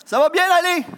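high, loud mocking echo of the slogan; sarcastic sing-song of a broken promise; mock reassurance.

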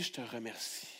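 low, hushed thanks; whispered-soft prayer. Shown as a demonstration. Preacher models a prayer of faith for the church, hushed and tender. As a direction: pray it tenderly to God.

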